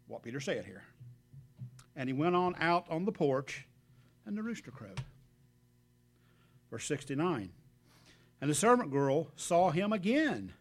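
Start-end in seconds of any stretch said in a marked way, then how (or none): 1.9-10.5 louder, measured Bible reading; scripture reading. Acted. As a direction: proclaim the verses to the congregation. Preacher reads scripture aloud.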